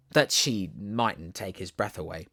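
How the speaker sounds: plain reading voice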